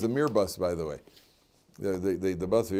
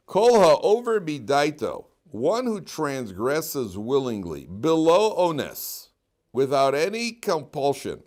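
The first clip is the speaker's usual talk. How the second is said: slow, higher, louder recitation; preaching; 5 semitones higher, 7 dB louder, much slower than usual. Acted. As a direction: intone the text like a sermon. Reading Rambam in chant.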